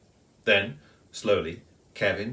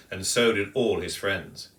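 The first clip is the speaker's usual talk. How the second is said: ordinary delivery, nothing marked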